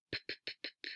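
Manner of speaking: soft unvoiced lip-popping engine noise; motorcycle sputter. A narrator's reading. Mouse shows Garf his motorcycle noise, sputtering softly. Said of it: "that's the noise I make to run it".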